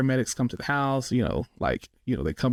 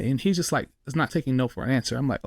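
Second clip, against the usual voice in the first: ordinary delivery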